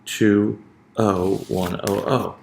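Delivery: each digit of "two O one O O" spoken slowly and separately; slow pattern reading. Shown as a demonstration. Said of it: "So that'll be O".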